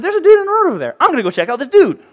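high, eager curiosity; lightly voiced, curious Joshua. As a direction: sound keen to check out the stranger. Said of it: "but Joshua said".

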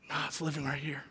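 soft unvoiced whisper, leaning in close. Real, not acted. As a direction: whisper it like a secret. Intimate point in sermon.

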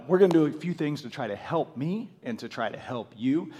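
ordinary delivery

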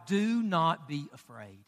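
low, soft and slow; hushed quote of God. Retold or imitated. Quotes God's words, dropping voice.

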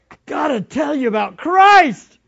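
high, loud excited outburst; shouting with excitement. Acted. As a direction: burst out with joy about Christ. Preacher acts excited believer.

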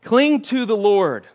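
loud, high, drawn-out plea; pleading. Acted. Moses pleads with his people, urgent and imploring. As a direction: beg them, stretching each word slowly and forcefully. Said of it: "he's just pleading with them".

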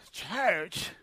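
flat, disbelieving "Church?"; deadpan like it's the last thing you need; mock exhausted disbelief; incredulous. Tired reply to invitation.

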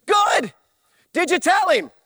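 high, loud shouted good; loud approval. Acted. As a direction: bark out your approval. Preacher approves loudly.

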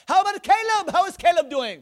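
high, eager mimicked shout; Brother Vic. Missionary asking after yet another child, imitated as shouting. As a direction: fire questions loudly down the phone. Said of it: "Why are you shouting?".

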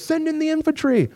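high-pitched mock order; imitated general's high call; retold commanding call.

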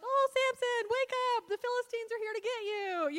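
high, loud sing-out of fake alarm; Delilah's mock alarm. Woman waking Samson as the Philistines close in, mock alarm. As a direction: call out in a put-on high voice, feigning panic.

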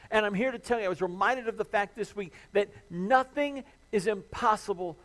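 loud, high impassioned sermon climax; full-force preaching. Acted. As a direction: preach it out with everything.

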